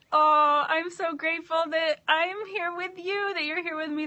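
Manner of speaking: host gushing thanks to the whole circle; excited gratitude. Real, not acted. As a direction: burst out with joy.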